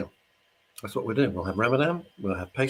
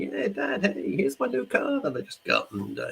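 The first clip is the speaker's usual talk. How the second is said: put-on much higher, breezy young voice; imitating son; 13 semitones higher than usual. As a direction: play the cheerful son showing off. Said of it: "And he's just saying".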